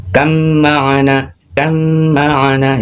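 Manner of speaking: drawn-out kam ma'ana with a held mm; slow recitation demo. Shown as a demonstration. Teacher repeating the example slowly. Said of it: "Contoh bacanya".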